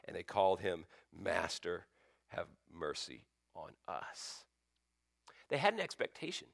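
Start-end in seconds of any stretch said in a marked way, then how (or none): 1.1-4.5 soft, slow plea dropping away; hushed plea. Retold or imitated. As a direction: plead gently from afar. Lepers calling to Jesus.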